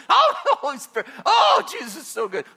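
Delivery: giggly high voice; being tickled. Retold or imitated. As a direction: laugh through the words. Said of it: "Holy Spirit's, like, tickling me".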